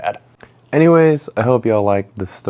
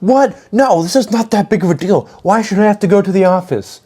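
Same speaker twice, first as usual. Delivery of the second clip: put-on high, whining protest of a kid caught out; whiny Melvin; 9 semitones higher than usual. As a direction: whine it like you can't believe you're in trouble. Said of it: "And this man is like".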